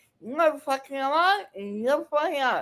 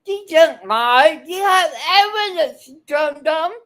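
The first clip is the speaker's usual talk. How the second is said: angry retort; 6 dB louder than usual. Real, not acted. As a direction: shut him down with contempt. Defending the woman, shouting at him.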